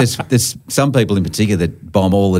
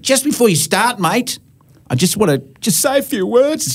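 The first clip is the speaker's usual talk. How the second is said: higher, put-on voice of a teary woman at a memorial; mimicking the woman. Comic doing the widow asking to say a few words first, in a higher mock voice. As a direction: play the grieving woman making a speech. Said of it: "this l-, woman just went".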